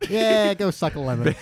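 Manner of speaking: put-on slow, tough mobster accent; Chicago mobster accent. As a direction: play a mock Chicago gangster. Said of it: "You sound like a Chicago mobster".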